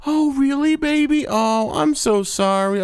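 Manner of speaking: high, syrupy, put-on girly voice; mock sweet girlfriend. Girlfriend cooing apology to her boyfriend, high mock sweetness. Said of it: "And she's like".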